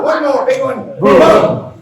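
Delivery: high, loud final push; rallying shout. Real, not acted. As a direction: drive them through the last big one.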